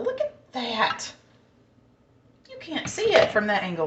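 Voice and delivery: ordinary delivery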